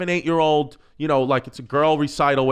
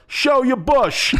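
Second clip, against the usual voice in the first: high, drawn-out holler, close to laughing; loud mock heckle; retold yell; 11 semitones higher, 4 dB louder, much slower than usual. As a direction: yell it out like dad heckling the stage. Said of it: "my dad just yells".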